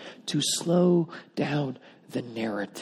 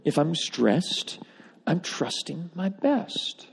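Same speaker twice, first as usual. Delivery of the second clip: softer and very slow; quiet emphasis; 2 semitones higher, 6 dB quieter, much slower than usual. Shown as a demonstration. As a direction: let it sink in gently. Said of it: "proven to be true to my soul".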